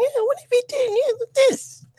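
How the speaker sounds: very high falsetto mock woman; mocking movie woman. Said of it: "She was like".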